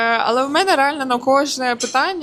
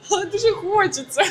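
laughing; 8 semitones higher, 3 dB quieter than usual. Real, not acted. Admits wanting, laughing.

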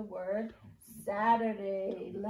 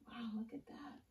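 whisper; 12 dB quieter than usual. Acted. Teacher marvels to kids.

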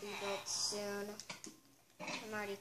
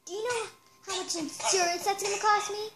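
higher and louder, indignant; angry complaint; 6 semitones higher, 7 dB louder than usual. Acted. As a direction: berate the clerk over the cost. Guest complains to clerk.

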